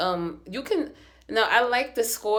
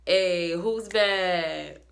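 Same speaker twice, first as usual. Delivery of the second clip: slow, drawn-out sassy challenge; sassy brag; much slower than usual. Pretend. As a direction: strut the line like the baddest witch. Sassy mock witch brag.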